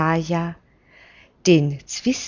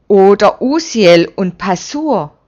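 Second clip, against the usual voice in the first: louder, forceful cut-in; vehement interruption; 2 semitones higher, 8 dB louder than usual. Acted. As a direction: burst in to cut them off. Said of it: "fällt Zacharias heftig ein".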